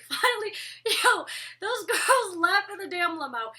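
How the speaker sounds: bursting with real excitement, laughing; excited laughing. Real, not acted. Telling viewers about the next episode: excited, laughing. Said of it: "I'm super excited".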